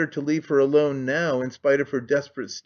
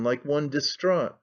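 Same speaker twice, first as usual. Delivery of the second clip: usual speaking voice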